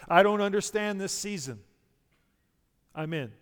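voicing a bewildered believer's complaint; confused. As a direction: act out confusion, insistent then deflated.

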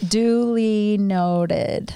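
a very slow, stretched "duly noted"; slow and drawn out. Acted. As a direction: drag out every syllable. Repeating the reply, drawn out.

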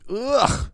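high, sharp shudder of disgust; a real shiver-of-revulsion ugh; disgusted groan. Real, not acted. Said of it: "Jag får fucking rysningar".

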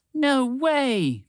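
high exclamation, cutting in; shout. Acted. Phoebe cutting in on the others, high disbelieving shout. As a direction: burst in with disbelief. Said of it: "interrupted with a shout".

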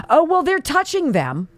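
ordinary talk

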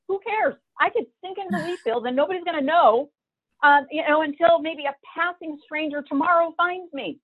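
high-pitched anguished cry to the sky; screaming at God. Retold or imitated. Alone in the wheat fields, crying out to God in a high wail. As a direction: shout up at the heavens in despair.

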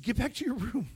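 high, loud staff order; mock scolding. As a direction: snap them back to bed as the nurses. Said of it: "They said".

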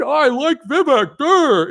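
high, loud dopey voice mocking a fan; mocking dimwit. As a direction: sneer at them as brainless fools. Mocking supporters as dumb.

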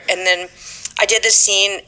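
ordinary delivery, nothing marked

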